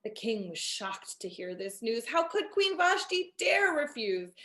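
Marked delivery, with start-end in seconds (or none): none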